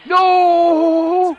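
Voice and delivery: high, loud, drawn-out cry of no; real despairing yell at a lost game; anguished shout. Real, not acted. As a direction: wail in disbelief, then repeat it. Bad play in overtime.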